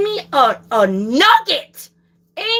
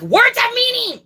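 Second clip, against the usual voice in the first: exasperated outburst; 10 semitones higher than usual. Real, not acted. Exasperated rant.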